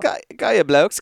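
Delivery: a playful, hammed-up British accent; mock English accent. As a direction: tease him in a put-on English accent. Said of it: "That's probably what I sound like right now".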